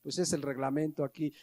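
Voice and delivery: gently and timidly ask them not to park there; polite attendant. Retold or imitated. Attendant to the father at the parking lot, soft and timid. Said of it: "te dice Omar o Rafa".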